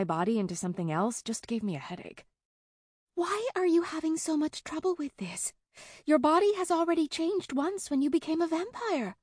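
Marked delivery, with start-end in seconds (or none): 3.1-9.2 put-on high, impatient child voice; exasperated young girl. A narrator's reading. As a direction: scold like a bossy little girl. Child vampire Helena scolding.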